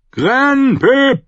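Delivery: high, loud yell; roaring names. A narrator's reading. Commander summons two men in battle, roaring. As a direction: bellow for two men to come. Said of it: "brüllte John".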